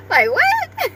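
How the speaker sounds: squeaked out high through tears of laughter; can barely get it out for laughing; laughing disbelief. Real, not acted. Owner asking what the dog said, crying with laughter.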